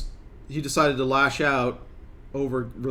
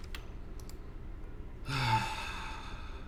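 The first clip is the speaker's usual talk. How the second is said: weary sigh; 3 semitones lower, 14 dB quieter than usual. Real, not acted. After colonist's morale issues.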